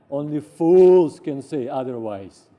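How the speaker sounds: the guru insisting on the sweet rice, slow and loud; emphatic guru. Retold or imitated. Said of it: "He said".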